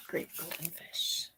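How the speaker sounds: ordinary delivery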